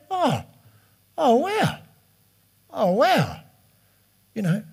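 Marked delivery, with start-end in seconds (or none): 0.1-3.4 high, drawn-out mock amazement; mock wow. Shop guy reacting to meeting a pastor, mock amazed wow. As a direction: play his stunned wow, big and high. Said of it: "And then he went".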